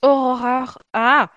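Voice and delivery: Aurora in French-tinged English accent. Shown as a demonstration. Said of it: "j'ai pas un accent anglais".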